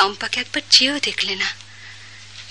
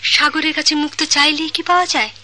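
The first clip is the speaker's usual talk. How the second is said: ordinary delivery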